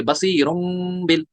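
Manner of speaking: बसीरुन drawn out to a hummed M before बिल; iqlab with ghunna. Shown as a demonstration. Teacher reading it joined, बसीरुन humming into बिल. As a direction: slowly hold an M from बसीरुन into बिल. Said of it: "मीम साकिन में बदलेंगे और plus गुन्ना करेंगे".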